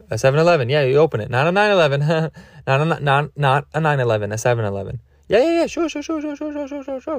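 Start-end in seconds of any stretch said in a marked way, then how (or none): 5.3-7.2 much higher, soft rapid "sure, sure" in a put-on voice; hammed-up eager yes-man, light and high; mock eager agreeing. Mocking the accented driver, high, soft put-on agreeing.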